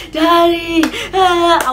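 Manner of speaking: high mock wail for Daddy; crying. Flogged child crying for dad, high imitated wail. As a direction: cry out for Dad in exaggerated distress. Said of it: "I was crying then".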